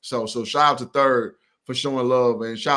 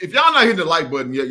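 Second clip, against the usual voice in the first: louder, faster call to action; loud hype; 4 semitones higher, 7 dB louder, faster than usual. Real, not acted. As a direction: push the audience hard. Host urging viewers to like.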